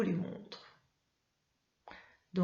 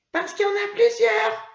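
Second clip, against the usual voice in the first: put-on much higher and louder childlike answer; Mimi puppet voice; 14 semitones higher, 9 dB louder than usual. Puppet Mimi answering teacher's question, high loud childlike. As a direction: pipe up with the answer.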